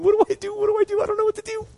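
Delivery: high, flustered contestant voice; panicked contestant. Retold or imitated. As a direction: play the lost contestant at a loss. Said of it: "the last person's up there going".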